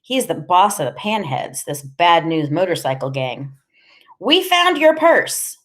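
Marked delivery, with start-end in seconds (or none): 4.2-5.6 high, drawn-out anxious blurt; sudden high-pitched outburst. A narrator's reading. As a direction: blurt it high, stretching the words. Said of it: "Freak blurts out".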